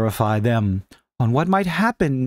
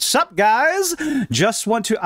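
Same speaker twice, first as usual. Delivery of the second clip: mock Cabrutus; 7 semitones higher, slower than usual. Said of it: "I wonder if I could do his accent".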